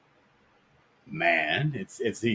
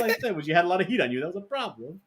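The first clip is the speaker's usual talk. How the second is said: higher, fast movie mobster retort; mock De Niro reply; 9 semitones higher, faster than usual. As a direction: rattle off the mobster's defence. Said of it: "he's like".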